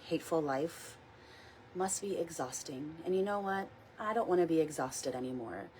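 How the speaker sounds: soft, unhurried meditation guide voice; gentle guided meditation read. Acted. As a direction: ease the listeners into calm. Leads a weekly guided meditation.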